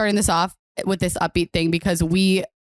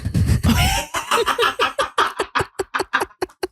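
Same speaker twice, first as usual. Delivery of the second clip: shrieking laugh. Real, not acted. Remembers absurd dream.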